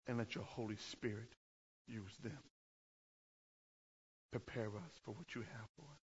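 low, soft, unhurried prayer. Acted. Pastor opening the sermon with prayer, soft prayer voice. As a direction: pray quietly over the congregation.

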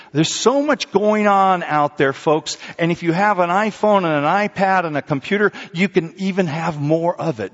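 high, loud sermon push; preaching. Acted. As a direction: warn the folks with force. Preaching at congregation.

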